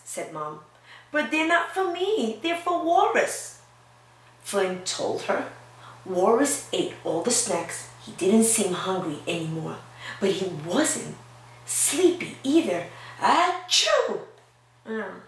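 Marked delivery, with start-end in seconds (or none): 1.1-3.6 high, earnest protest; protesting child. Acted. Boy explains to Mom the snacks are for Walrus, high and earnest. 13.2-14.2 high mock sneeze. Walrus sneezes in the story, a high mock sneeze. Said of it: "Sneezed Walrus".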